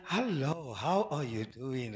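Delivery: low, slow put-on voice; a deep, drawn-out mock greeting; changed God voice. Shown as a demonstration. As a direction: play a changed voice, low and measured. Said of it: "Imagine God's voice is one day".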